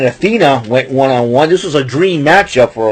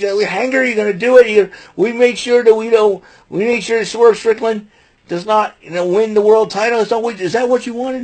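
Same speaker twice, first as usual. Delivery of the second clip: put-on taunting Young Bucks; 7 semitones higher than usual. Voicing Young Bucks at Hangman.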